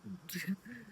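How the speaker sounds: soft embarrassed giggle; a quiet self-conscious little laugh; giggling softly at herself; shy laugh. Real, not acted. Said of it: "मुझे हंसी आ रही है".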